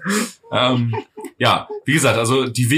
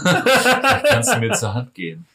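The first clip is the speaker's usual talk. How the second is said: coy, raised flirty tone; flirty high voice; 8 semitones higher than usual. Pretend. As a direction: offer help with a wink. Playing flirty scene.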